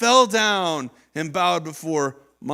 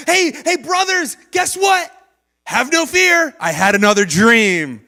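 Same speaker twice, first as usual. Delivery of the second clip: high, loud eager call to his brothers; excited shout; 5 semitones higher, 4 dB louder than usual. Retold or imitated. Joseph calling out to his brothers, high and excited. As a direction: burst in shouting the big news.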